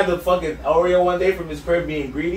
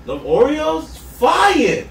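retold rave, higher and louder, almost shouting; excited rave; 8 semitones higher, 3 dB louder, much slower than usual. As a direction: hype the cookies up like a revelation. Retelling excited discovery of Oreos.

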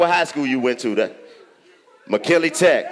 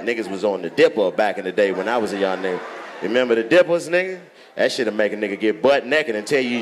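usual speaking voice

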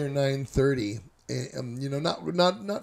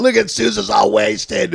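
loud teasing call, deeper; teasing shout; 5 semitones lower, 7 dB louder than usual. Retold or imitated. Partygoer teases the wasted narrator, loudly. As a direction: shout it out, mocking. Guests mocking his state.

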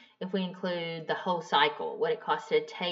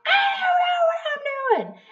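much higher, louder, whiny sob-voice; mock crying wail; 6 dB louder than usual. Woman admitting ignorance to her husband, high mock sob. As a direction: whimper it like a frustrated kid.

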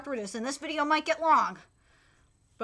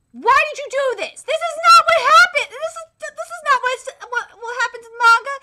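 loud, high protest at the studio; betrayed fan yelling at the studio; angry rant; 7 semitones higher, 7 dB louder than usual. Real, not acted. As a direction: vent outrage at the bad writing. Fan angry at adaptation changes.

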